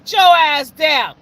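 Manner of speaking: loud, slow heckle; heckling shout. Retold or imitated. Heckling the dancing graduate, loud slow shout. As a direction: yell at her to get off stage. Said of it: "booed that bitch like I was at a concert".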